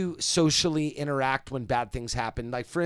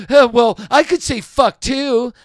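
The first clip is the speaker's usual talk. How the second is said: mocking imitation; 8 semitones higher, 12 dB louder, much slower than usual.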